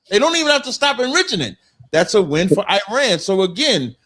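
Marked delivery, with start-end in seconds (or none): 0.1-1.6 high and fast, heated; fired-up rising pitch; worked up. Real, not acted. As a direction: rush it out, getting riled. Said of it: "You get a little emotional there".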